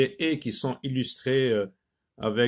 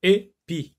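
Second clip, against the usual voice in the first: "épi" cut in two, pitched higher; syllable by syllable; 4 semitones higher than usual. Shown as a demonstration. Teacher asking where the É is, "épi" syllable by syllable. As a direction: sound out "épi" with a gap between syllables. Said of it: "Dans la première syllabe".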